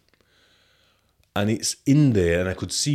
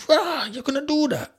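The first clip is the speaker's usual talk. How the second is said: much higher, incredulous rah; shocked inner thought. Retold or imitated. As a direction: disbelief at the boast, squeaked high. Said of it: "I'm thinking".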